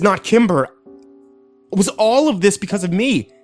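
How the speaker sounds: high, desperate questioning; anguished outburst. A narrator's reading. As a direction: plead against it in rising panic. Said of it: "I punched the steering wheel".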